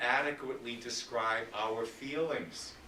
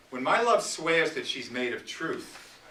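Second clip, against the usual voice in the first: sonnet recitation; 4 semitones higher, 5 dB louder than usual. Acted. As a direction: start the sonnet with a measured lift. Begins Sonnet one thirty-eight.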